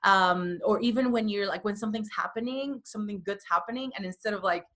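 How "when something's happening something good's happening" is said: usual speaking voice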